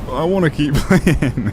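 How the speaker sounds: high, slow laughing whine; whiny laughing. Real, not acted. Whining about server shutdown.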